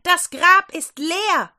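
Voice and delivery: high, loud and slow, bursting with news. Acted. Maria bursts in on the grieving disciples, loud and excited. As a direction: blurt it out, overwhelmed. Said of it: "platzten die Frauen heraus".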